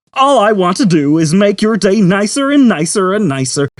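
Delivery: cheery computer. A narrator's reading. Computer greeting Zaphod, sugary cheer. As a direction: greet like a game-show host.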